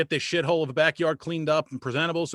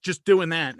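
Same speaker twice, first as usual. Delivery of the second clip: usual speaking voice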